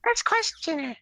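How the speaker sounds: high character voice. Acted.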